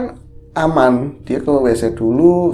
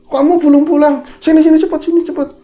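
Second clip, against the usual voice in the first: much higher and louder, come quick; urgent calling; 7 dB louder, faster than usual. Retold or imitated. Mother calling the neighbor over for help, urgent. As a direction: wave them over in a hurry.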